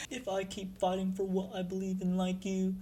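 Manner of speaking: high, soft, halting timid voice; timid trembling. Acted. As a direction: ask it haltingly, small and nervous. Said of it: "kinda trembling".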